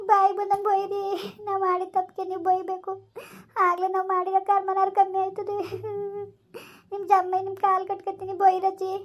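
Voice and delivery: high, sobbing plea; tearful begging. Acted. Granddaughter begging grandmother to scold her, crying. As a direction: plead desperately through tears.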